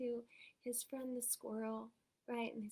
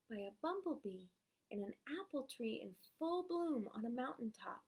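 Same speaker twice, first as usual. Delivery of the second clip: plain reading voice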